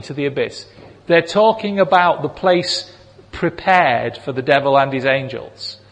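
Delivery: usual speaking voice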